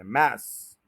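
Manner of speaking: मैस hit hard and low; full stress. Shown as a demonstration. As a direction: punch मैस with full energy. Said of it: "पूरी energy से बोलें".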